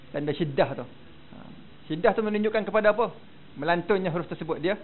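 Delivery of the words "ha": ordinary talk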